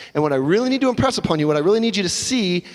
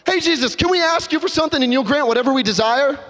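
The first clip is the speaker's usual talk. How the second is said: high, bright-eyed ask; eager request; 7 semitones higher than usual. Acted. Disciples asking Jesus a favor, higher and eager. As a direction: ask like nothing is wrong.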